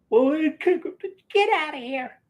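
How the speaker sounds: high quoted voice. Retold or imitated. Sister chasing them off.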